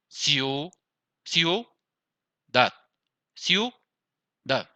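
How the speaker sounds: ciudad drawn out as ciu... dad; split into syllables. Shown as a demonstration. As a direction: hold ciu in ciudad, pause, then drop on dad. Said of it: "está en una sola sílaba".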